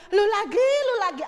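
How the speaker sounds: put-on exasperated God groaning at the same old sinner. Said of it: "Jangan sampai Tuhan bilang". (Tuhan yang jengkel, lebih tinggi dan keras)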